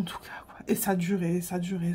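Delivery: hushed, tired murmur; a softly whispered, exhausted aside; weary whispered aside. Real, not acted. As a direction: whisper how endless it was. Weary aside about the long talk.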